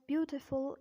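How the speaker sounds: British "beautiful" with a crisp T; British-accent demo of beautiful. Shown as a demonstration. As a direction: say beautiful the British way, T fully sounded. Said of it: "in British accent".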